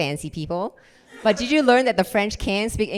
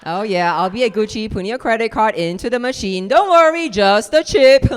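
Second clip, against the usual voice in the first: mock boyfriend voice; 5 semitones higher, 8 dB louder than usual. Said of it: "boyfriend quote".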